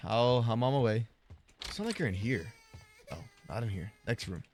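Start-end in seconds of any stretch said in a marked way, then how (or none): none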